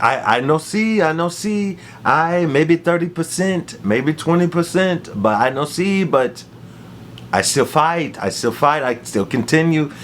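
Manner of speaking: halting imitated Cuban accent; broken English in a mock Cuban accent; Waldo Cortez Acosta impression. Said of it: "in his Cuban accent, he begins to talk".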